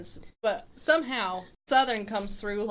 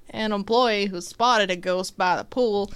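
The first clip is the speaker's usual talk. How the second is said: put-on Southern accent; 3 semitones higher, 5 dB louder than usual. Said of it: "Your voice is so southern".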